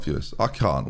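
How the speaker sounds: usual speaking voice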